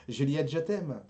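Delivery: ordinary talk, nothing marked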